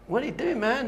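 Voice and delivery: high-pitched baffled question; incredulous lion. Acted. Lion questioning a bleating lion cub, incredulous. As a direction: ask it in comic disbelief.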